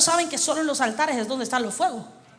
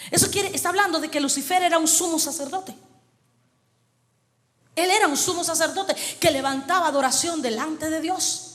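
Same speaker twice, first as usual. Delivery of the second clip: ordinary talk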